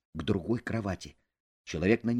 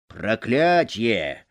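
slow, loud curse; cursing; 7 dB louder, much slower than usual. Acted. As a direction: spit out the curse. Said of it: "выругался человек".

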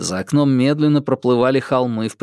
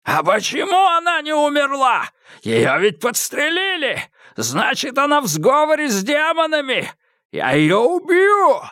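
crazed madman; 14 semitones higher than usual. A narrator's reading. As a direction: spit it out like a lunatic. Madman threatens to kill girl.

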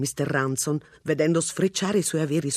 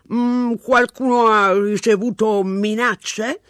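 slow, louder chewing question; mouth full; 7 semitones higher, 6 dB louder, slower than usual. A narrator's reading. Policeman questioning Mr Ransom while chewing. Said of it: "stava masticando qualcosa".

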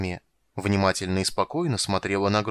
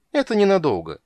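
higher, louder voice, soothing the king; reassuring; 10 semitones higher, 6 dB louder than usual. A narrator's reading. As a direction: reassure him confidently. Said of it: "успокоил Крысюк". (успокаивающе)

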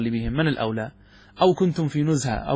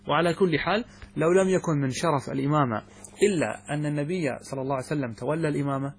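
ordinary delivery

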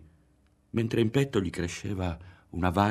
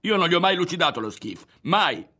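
high, loud, angry protest; indignant yell; yelled denial; 9 semitones higher, 5 dB louder than usual. A narrator's reading. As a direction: shout it fast, outraged at the accusation. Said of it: "urlò Ario".

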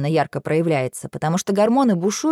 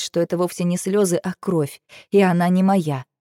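ordinary delivery

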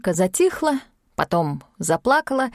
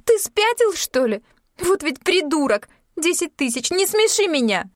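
indignant scorn; 9 semitones higher than usual. Acted. Yulka scorns Sashka's offer.

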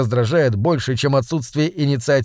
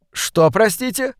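higher, slow, faintly offended; mildly indignant; 8 semitones higher, slower than usual. A narrator's reading. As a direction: let a slight indignation show. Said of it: "слегка возмутился Эдвард".

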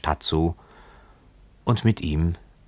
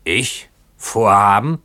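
put-on drunken boatswain's cry, growing louder and higher; indignant shout; 5 semitones higher, 5 dB louder, slower than usual. A narrator's reading. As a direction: throw the question back, shouting the last word. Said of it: "schrie der Bootsmann".

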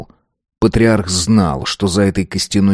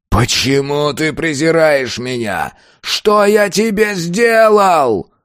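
much higher, slow raging cry; anguished scream; 8 semitones higher, slower than usual. A narrator's reading. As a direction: scream it in despair. Monster screams at girl.

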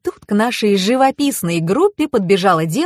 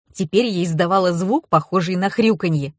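usual speaking voice

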